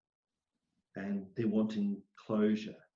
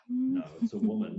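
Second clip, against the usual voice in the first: insistent no; 12 semitones higher, 6 dB louder than usual. Retold or imitated. Retelling insisting to his friend.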